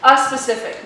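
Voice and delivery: "A specific" said firmly, with "A" standing out; stress on A. Shown as a demonstration. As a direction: punch the article "A" as a correction. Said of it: "not an".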